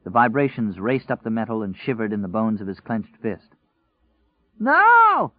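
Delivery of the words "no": high, loud scream of panic; desperate scream. A narrator's reading. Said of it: "he screamed".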